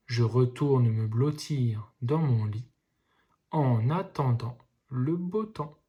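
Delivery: smooth reading at normal pace; second reading, flowing and clear. Shown as a demonstration. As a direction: read it fluently for the class. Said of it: "pour avoir une lecture normale".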